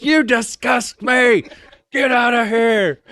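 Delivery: high, loud, slow disgusted yell; top of his lungs. Retold or imitated. Drill sergeant dismissing the recruit in disgust, slow loud yell. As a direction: spit out each word at full volume. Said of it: "at the top of his lungs".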